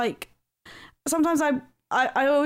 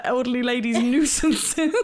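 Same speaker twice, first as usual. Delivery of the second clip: ordinary delivery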